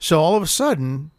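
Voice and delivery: normal talking voice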